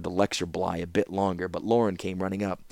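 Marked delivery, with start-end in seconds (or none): none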